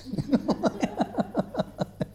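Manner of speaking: quiet high giggle; soft high laugh. Real, not acted. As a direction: giggle at the memory. Laughs at own naivety.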